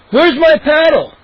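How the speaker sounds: high, loud demanding holler; bellow. A narrator's reading. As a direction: bellow it out across the room. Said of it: "Moose bellowed".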